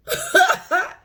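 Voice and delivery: sudden high burst of laughter. Real, not acted. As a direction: cackle it out, amused. Laughs before ranting.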